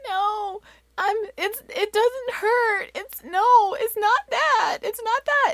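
put-on very high, drawn-out little-kid whine; imitated crying child. Daughter to mom, high imitated tearful protest. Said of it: "she's just crying".

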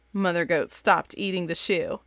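lively bedtime-story read-aloud; reading to kids. Acted. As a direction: read it like you're sitting with your kids at bedtime. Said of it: "the same voice that I do for my kids".

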